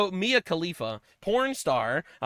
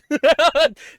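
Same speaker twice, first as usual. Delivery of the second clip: loud laugh; 9 semitones higher, 9 dB louder than usual. Real, not acted. Laughs at own joke.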